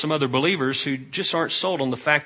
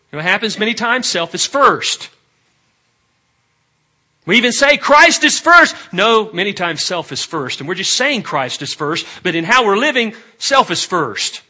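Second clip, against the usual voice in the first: loud, emphatic exhortation; forceful preaching; 6 semitones higher, 10 dB louder than usual. Real, not acted. Preacher exposing the congregation's self-first living, loud and forceful. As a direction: press the point home loudly.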